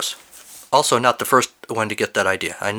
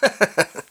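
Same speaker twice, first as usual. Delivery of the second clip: high, amused chuckle; laugh; 10 semitones higher than usual. Real, not acted. Host tickled by a detail in the story, laughing. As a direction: break into a short laugh. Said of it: "I'm laughing because I just noticed".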